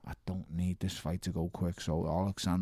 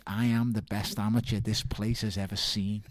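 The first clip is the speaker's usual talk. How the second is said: ordinary talk, nothing marked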